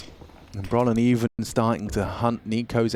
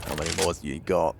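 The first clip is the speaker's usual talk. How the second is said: ordinary delivery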